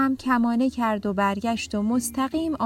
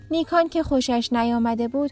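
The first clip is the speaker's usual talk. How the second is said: ordinary delivery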